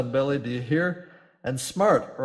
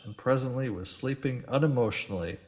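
plain reading voice

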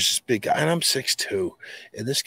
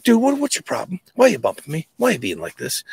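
higher, snippy Boston comeback; snippy Boston retort; 9 semitones higher, 3 dB louder than usual. Retold or imitated. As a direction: get in his face, snippy and Boston. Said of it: "got all snippy and all Boston in his face".

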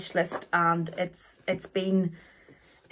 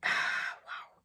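awed whisper; 8 dB quieter than usual. Real, not acted. Gazing at the painting.

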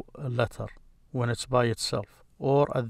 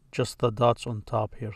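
normal talking voice

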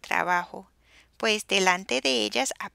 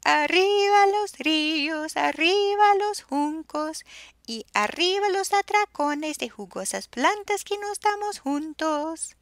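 joyful hooting; 7 semitones higher, 5 dB louder than usual. A narrator's reading. Hadrosaur herd hoots joyfully by the river, high. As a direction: hoot it full of joy. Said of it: "y ululaban".

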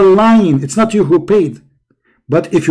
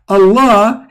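usual speaking voice

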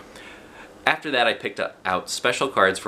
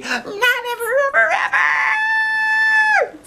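very high, loud, stretched squeal; squealy shout; 6 dB louder, much slower than usual. A narrator's reading. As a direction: shriek with joy. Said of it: "I said real squealy".

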